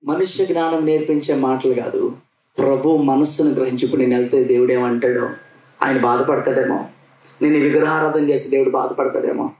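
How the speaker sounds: ordinary talk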